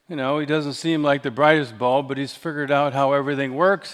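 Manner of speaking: normal talking voice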